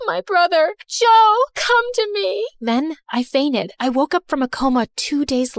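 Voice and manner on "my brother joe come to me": high, pleading call for her brother, slowed at the end; desperate high cry, drawn out; crying out desperately. Acted. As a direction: cry out for Joe from the hospital bed. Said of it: "I cried out".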